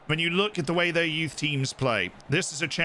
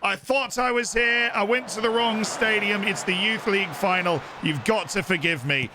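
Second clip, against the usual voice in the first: put-on a light English accent, apologetic and a bit higher; 4 semitones higher than usual. Streamer pretends to be a late English fan, light English accent. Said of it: "the English accent for the final".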